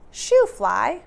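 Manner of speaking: loud playful shoo; mock shout at a pesky fly. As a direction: wave the fly off with a call. Shooing a fly in story.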